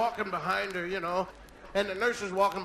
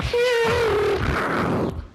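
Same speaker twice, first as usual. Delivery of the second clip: long, high, loud screech; vocal skid of a speeding car; screeching car noise; 14 semitones higher, 8 dB louder than usual. Acted. Husband racing wife to hospital, screeching car sound.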